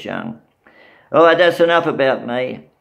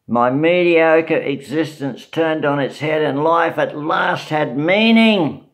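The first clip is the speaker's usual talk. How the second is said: ordinary talk, nothing marked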